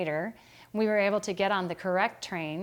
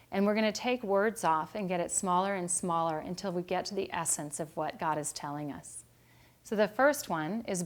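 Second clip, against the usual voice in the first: normal talking voice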